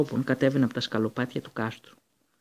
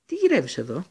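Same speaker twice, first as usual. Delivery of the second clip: louder, higher astonished question; exclaiming; 5 semitones higher, 5 dB louder than usual. A narrator's reading. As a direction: Ron asks his rat, louder surprised question. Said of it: "αναφώνησε ο Ρον".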